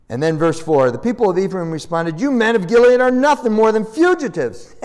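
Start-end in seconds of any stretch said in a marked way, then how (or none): 2.2-4.7 raised, taunting put-down; acted insult. Men of Ephraim answer Jephthah, taunting insult. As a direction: hurl it at them as a mock insult. Acting out tribe's insulting reply.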